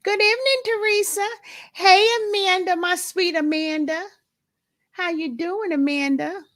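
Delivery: high, bright hello; excited greeting. Real, not acted. Greeting Teresa and Amanda on the live stream, high and gushing. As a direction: gush over each name.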